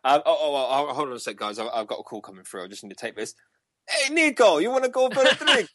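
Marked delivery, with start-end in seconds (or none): 3.9-5.7 much higher and louder, a put-on silly caller voice; hammed-up high voice asking a mate out for a drink; mock phone voice. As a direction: answer the fake call in a squeaky joke voice. Pretend phone call, put-on voice.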